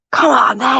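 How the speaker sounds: playful imitation of picking a fight; mock fight challenge. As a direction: imitate a man squaring up for a row. Imitates him starting a fight.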